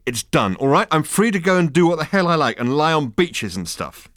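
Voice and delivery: loud, high furious outburst; savage. A narrator's reading. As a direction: hurl it out savagely. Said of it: "said Zaphod savagely".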